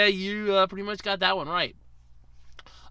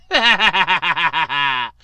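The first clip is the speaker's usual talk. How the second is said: loud laugh; 4 semitones lower, 10 dB louder than usual. Real, not acted. Laughs at Virtual Boy cases.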